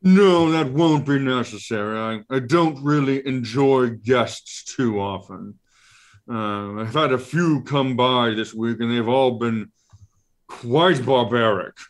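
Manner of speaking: Ralakor creature voice. Acted. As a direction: muse like a pompous eye creature.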